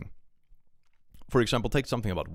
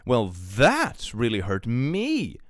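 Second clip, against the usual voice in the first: louder, slowed-down mock hurt; sarcastic slow wail of hurt; mocking whine; 4 semitones higher, 5 dB louder, much slower than usual. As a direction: ham up her self-pity.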